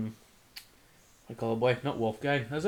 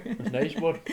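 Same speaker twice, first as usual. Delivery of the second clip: words muffled by food; mouth full; 5 semitones higher, much slower than usual. Real, not acted. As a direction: still chewing while talking. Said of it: "but stop talking with your mouth full".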